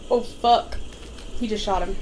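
ordinary talk

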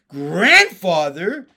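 loud, drawn-out cry of disbelief; stunned, rising exclamation; astonished cry. A narrator's reading. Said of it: "cried Jessie".

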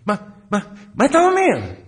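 high, loud stammered mock shout; incredulous yell, exploding on the question; enraged. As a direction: imitate a sergeant losing his temper. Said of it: "הכעס שלו ממש התעצבן".

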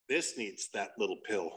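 low, soft mock solemnity; retold visitor voice. Comic retelling the visitor's verdict, low soft voice. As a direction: drop low and quiet for his line.

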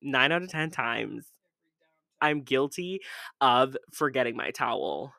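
normal talking voice

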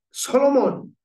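put-on loud Greek-accented call of the name. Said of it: "with his Greek accent".

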